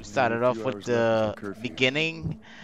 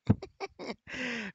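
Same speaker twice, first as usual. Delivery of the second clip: high, soft laugh; 12 semitones higher, 7 dB quieter than usual. Real, not acted. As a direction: break into a real laugh, pitched up. Streamer laughs at own aside.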